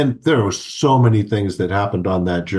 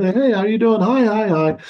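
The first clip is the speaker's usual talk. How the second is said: much higher, bright friendly hello; cheery greeting; 11 semitones higher than usual. Retold or imitated. Traveller greeting English speakers, higher cheery hello. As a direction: sing out a happy greeting to strangers. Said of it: "I speak in".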